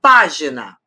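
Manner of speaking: página with a strong first syllable pá; stress on antepenultimate. Shown as a demonstration. Teacher showing a proparoxytone, página stressed on pá. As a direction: hit pá in página, let gina fall. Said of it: "a antepenúltima é a forte".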